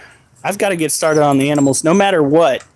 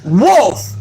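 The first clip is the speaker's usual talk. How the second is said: high, commanding one-word call; real sharp call; 6 semitones higher, 4 dB louder than usual. Real, not acted. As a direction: call a disobedient dog to order. Calling the disobedient dog.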